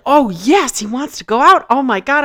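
excited. Retold or imitated. Thrilled husband wants to go out.

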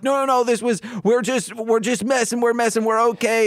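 high-pitched, flustered backpedalling; imitated frantic reassurance. Pranking kids to alarmed couple, high mock panic.

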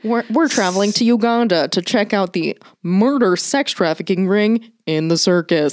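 deadpan documentary host parody; Vice News presenter. Acted. Podcaster pitching a circus crime exposé, mock Vice presenter voice. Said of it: "That sounds like a Vice documentary".

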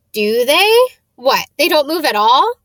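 amazed, rising questions. Acted. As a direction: Ron can't believe photos stay still. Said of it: "Ron sounded amazed".

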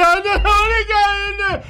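put-on high, whining little boy; a high childish plea; whiny kid voice. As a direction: play your scared little self. Child voice in retold story.

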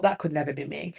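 soft, low aside to herself; inner thought. Retold or imitated. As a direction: murmur it as a private conclusion. Recalling her own private thought.

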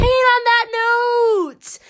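high, loud, drawn-out announcer call; announcer sign-off. Pretend. Host wrapping up the episode, hammed-up announcer voice. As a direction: stretch it out like a hammed-up show host.

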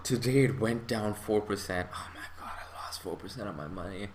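breathy panicked whisper of a scared investor. Retold or imitated. Mimicking a panicked investor checking the app, whispered. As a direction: hush it out like you just lost money.

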